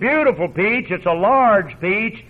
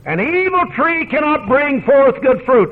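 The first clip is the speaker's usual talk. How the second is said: scripture proclaimed; 6 semitones higher, 4 dB louder than usual. Acted. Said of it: "so the Lord said".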